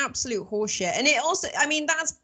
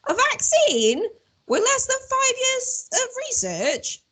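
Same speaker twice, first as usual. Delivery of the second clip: mock prissy voice. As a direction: play it as a prim sceptic. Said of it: "I thought".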